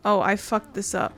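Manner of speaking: normal talking voice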